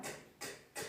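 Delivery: "k, k, k" whispered, no voice; voiceless k sound. Shown as a demonstration. Teacher showing the voiceless k sound to students. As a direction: click out a voiceless k. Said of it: "una K".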